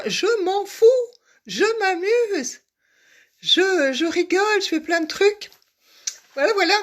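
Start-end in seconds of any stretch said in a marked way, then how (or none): none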